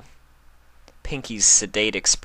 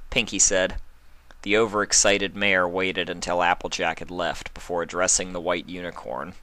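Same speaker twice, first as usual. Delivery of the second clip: plain reading voice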